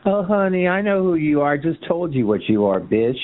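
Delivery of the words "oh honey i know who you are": mock campy honey. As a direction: parrot his campy honey back at him. Mocking the caller's campy voice.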